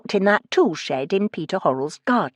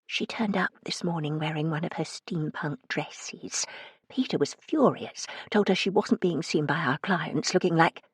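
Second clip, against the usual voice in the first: much softer, hushed confiding tone; lowered voice; 9 dB quieter than usual. Acted. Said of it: "lowered her voice".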